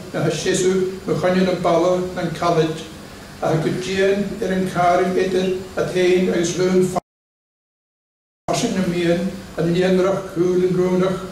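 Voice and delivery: normal talking voice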